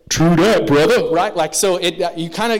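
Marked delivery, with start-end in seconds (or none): 0.1-1.1 loud, hyped-up slang impression; early two-thousands slang. Imitating someone agreeing in the early two thousands, loud hyped slang. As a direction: boom it out like a slangy buddy. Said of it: "someone would say that and go".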